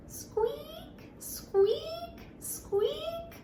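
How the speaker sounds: very high soft whispered squeak; high soft mouse squeak. Shown as a demonstration. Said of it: "Listen carefully".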